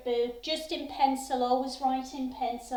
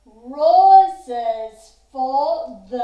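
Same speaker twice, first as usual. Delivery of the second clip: "Roses" stretched very slowly and loud; slow, loud chant hitting "Roses"; emphasis on roses; 14 dB louder, much slower than usual. Shown as a demonstration. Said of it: "I might want my emphasis here".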